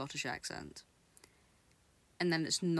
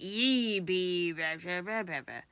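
mocking pirate-style Scottish accent, louder on the first words; fake Scottish pirate voice; 4 dB louder than usual. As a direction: parody a bad Scottish accent as an olden-days pirate. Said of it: "they speak like a pirate".

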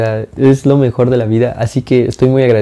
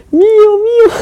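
put-on much higher childlike squeak of mine; eager claim; slower than usual. Fan telling Megadeth staff the Teletubby is his, high childish squeak. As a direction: squeal it out like a kid grabbing his toy.

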